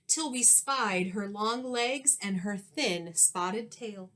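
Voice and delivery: ordinary delivery, nothing marked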